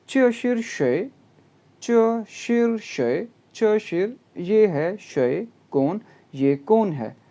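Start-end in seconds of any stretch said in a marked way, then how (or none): 0.1-3.3 "चे शिर शे" said higher and slowly; slow dialogue reading. Shown as a demonstration. Teacher reading a Chinese dialogue line slowly. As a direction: read "चे शिर शे" high and drawn out. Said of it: "last text पढ़ते हैं".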